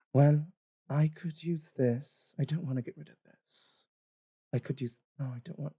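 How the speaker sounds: lightly voiced woman talking to herself; indecisive, trailing off; half-elven woman sorting. Half-elven woman muttering over belongings.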